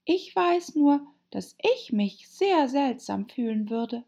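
put-on high-pitched girl character; Alice, earnest and high; polite Alice. A narrator's reading.

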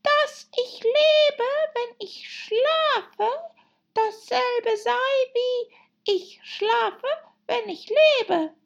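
extremely high, drawn-out squeak; sleep-talking dormouse. Acted. Dormouse talking in its sleep, high, slow and squeaky. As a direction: drag each word out as a sleeping dormouse. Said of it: "die im Schlaf zu sprechen schien".

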